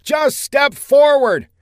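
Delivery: slow, high, loud shout; urgent shout. A narrator's reading. Saffinger directs the woman over crackling fire, shouting. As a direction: shout each word out over the noise.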